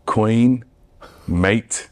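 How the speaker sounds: British accent on single words, spaced out. Shown as a demonstration. Showing the co-host a British accent on request, British accent. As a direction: try out a few British words, slowly drawn. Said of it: "Can you do British?".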